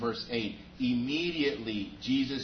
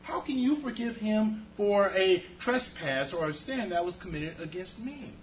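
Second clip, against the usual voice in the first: much higher, incredulous protest; indignant objection; 8 semitones higher than usual. Retold or imitated. Robbed man protests to Pastor Brady, high incredulous. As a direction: squeak with disbelief at the pastor. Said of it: "I would look at Pastor Brady and say".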